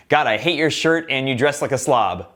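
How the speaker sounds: ordinary talk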